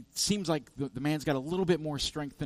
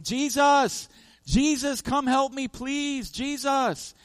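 high, loud cry for help; desperate yelling; 13 semitones higher, 9 dB louder, slower than usual. Retold or imitated. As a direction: scream to Jesus for rescue while being beaten down. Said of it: "he's yelling".

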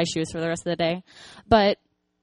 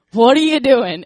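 much louder, higher startled what; startled question; 4 semitones higher, 12 dB louder than usual. Retold or imitated. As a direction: react surprised to the tug on your hair. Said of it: "I'm like".

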